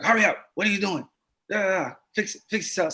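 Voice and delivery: a hint of a brusque father; impatient dad. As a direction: hurry the kid along with a touch of irritation. Father barking orders, imitated.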